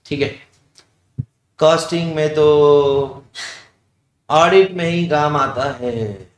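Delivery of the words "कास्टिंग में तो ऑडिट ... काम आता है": imitating a slow, drawn-out elderly caller; slow imitation of the 55-year-old's tone; slow old man's tone. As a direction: mimic his slow, low-key way of talking. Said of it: "ये tone ऐसे धीरे में".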